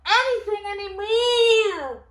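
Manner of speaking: high, loud, slow fake sob; mock despair. Reader wailing to viewers about a confusing book, hammed-up sob. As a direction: wail it like the book broke you.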